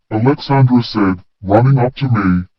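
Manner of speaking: ordinary delivery, nothing marked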